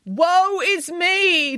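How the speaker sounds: high, very slow theatrical lament; hammed-up woe. A narrator's reading. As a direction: wail it like a bad actor. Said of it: "declared Missus Heseltine".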